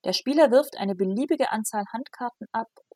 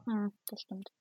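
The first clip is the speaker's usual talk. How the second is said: whispering; 10 dB quieter than usual. Real, not acted. Quiet agreement.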